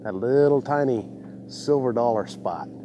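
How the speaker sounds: ordinary talk, nothing marked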